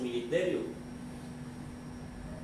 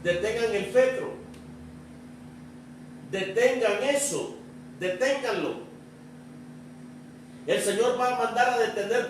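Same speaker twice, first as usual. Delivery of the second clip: raised voice, emphatic pulpit commands; commanding preaching; 7 semitones higher than usual. Acted. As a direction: preach it as an order to the congregation. Preaches commands at full force. (predicación con voz de mando)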